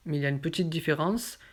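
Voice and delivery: ordinary delivery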